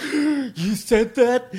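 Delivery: mock gasp, then slow, high-pitched disbelief; exaggerated high, loud shocked reaction; scandalized shock. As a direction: act out an appalled listener, gasping and drawing it out. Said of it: "they just get so like".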